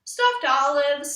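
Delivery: usual speaking voice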